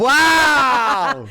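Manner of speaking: high, loud, drawn-out wow; mock amazement. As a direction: exclaim in exaggerated disbelief. Mock amazement at host's ignorance.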